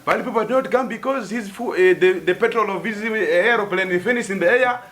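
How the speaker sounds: hammed-up gossip quote; mock rumour voice. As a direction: play the excited rumour-monger. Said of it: "Some people are saying".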